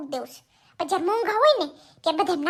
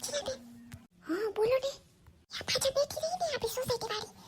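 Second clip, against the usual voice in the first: much softer gossiping murmur; gossip whisper; 3 semitones higher, 10 dB quieter than usual. Acted. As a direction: lean in and gossip so she won't hear. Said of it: "ધીરે બોલ, સાંભળે છે". (ધીમા અવાજે ગુસપુસ)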